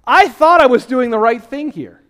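a mock "hold on, Lord" objection; protesting to God. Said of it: "You're like".

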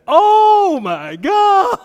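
slow, high, loud stunned cry. Retold or imitated. Young pastor hearing he must heal, stunned. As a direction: drag it out in shock.

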